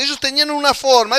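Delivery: ordinary talk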